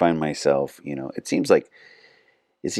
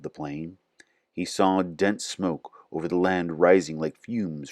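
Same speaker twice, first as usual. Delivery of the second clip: measured, reverent reading of scripture. Acted. Reading scripture aloud to viewers, measured and reverent.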